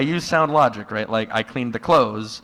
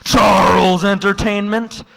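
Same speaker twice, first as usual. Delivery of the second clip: high, loud and slow, savoring each word; triumphant reveal; 9 semitones higher, 7 dB louder than usual. Retold or imitated. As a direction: lay out the big revelation. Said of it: "But then he said".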